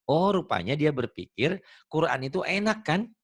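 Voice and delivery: ordinary delivery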